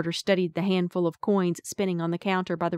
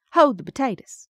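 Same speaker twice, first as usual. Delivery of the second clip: louder, higher Appalachian mountain twang; 6 semitones higher, 6 dB louder than usual. A narrator's reading. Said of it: "in my mountain twang".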